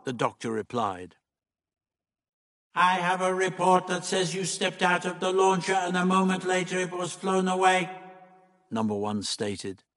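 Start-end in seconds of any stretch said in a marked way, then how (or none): 2.7-7.9 higher, droning robotic monotone; level mechanical accusation; stern Monoid leader. Acted. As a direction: state it like an alien machine.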